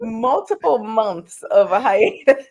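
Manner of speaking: laughing through words. Real, not acted. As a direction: laugh while you say it. Laughing at hiatus.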